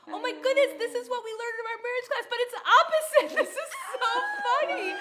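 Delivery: very high, loud, laughing through the words; helpless laughter. Retold or imitated. Wife retelling her fit of laughter on the drive, laughing helplessly. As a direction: squeal it out between bursts of laughter. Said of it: "I couldn't stop laughing".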